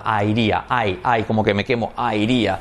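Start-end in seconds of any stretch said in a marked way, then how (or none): none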